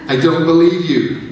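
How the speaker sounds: loud, defiant shout. A narrator's reading. Amy shouting at the alien voice, disbelieving. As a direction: throw it back at the voice. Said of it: "shouted Amy".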